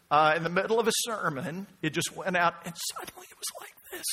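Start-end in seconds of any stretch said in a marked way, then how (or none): 2.9-4.1 thin, high, soft squeak of a lost voice; high and faint, barely any voice. Shown as a demonstration. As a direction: demonstrate a voice that just went out. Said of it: "when I lost my voice".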